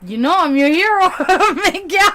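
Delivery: high, loud, laughing through the words. Real, not acted. Streamer teasing a viewer in chat, really laughing. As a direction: let real laughter break up the line.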